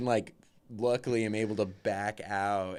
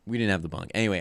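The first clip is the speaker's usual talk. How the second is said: usual speaking voice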